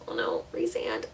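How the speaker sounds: breathless whisper of adoration; swooning whisper. Real, not acted. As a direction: whisper it like a smitten fan. Swooning over book boyfriend.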